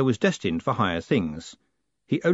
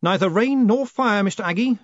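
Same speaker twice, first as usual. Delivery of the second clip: firm, clipped command; sharp; 9 semitones higher, 4 dB louder than usual. A narrator's reading. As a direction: cut him short with the motto. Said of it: "said Moist sharply".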